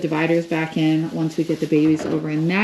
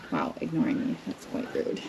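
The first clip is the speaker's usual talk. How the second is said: whispered, lower mock-hurt aside; mock-indignant whisper; whispered mock offense; 12 semitones lower, 7 dB quieter than usual. Real, not acted. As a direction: pretend to be offended, under your breath. Goat ignores her call.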